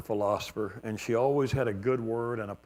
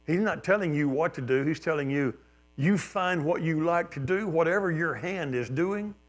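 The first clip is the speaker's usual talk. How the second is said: ordinary delivery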